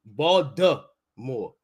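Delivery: ordinary talk, nothing marked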